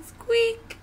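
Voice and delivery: high squeaky yelp; tiny high-pitched squeak; little lion's squeak. Acted. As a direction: squeak it high like a small animal. Little lion squeaks back.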